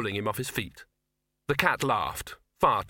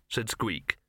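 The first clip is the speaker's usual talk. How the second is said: ordinary delivery, nothing marked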